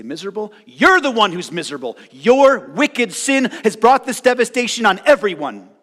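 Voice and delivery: friends retorting. Retold or imitated. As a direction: throw the word back in his face, loudly.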